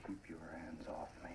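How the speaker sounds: cold, hissed threat; menacing whisper. Acted. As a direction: push her away in a whisper. Husband rebuffs wife in quarrel.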